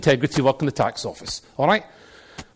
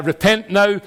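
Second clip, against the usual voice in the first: higher, louder mock proclamation; sandwich-board street preacher; 6 semitones higher, 5 dB louder than usual. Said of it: "an old man with a sandwich board".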